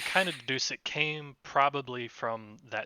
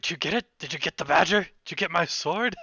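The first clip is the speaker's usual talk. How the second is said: higher, eager rapid-fire questions; perking up; 6 semitones higher, 6 dB louder than usual. Acted. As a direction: perk up with mounting hope, louder and higher. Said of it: "he kind of perks up".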